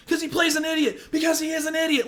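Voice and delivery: angry shout. Real, not acted. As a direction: yell it in disbelief at the actor. Shouting rant about actor.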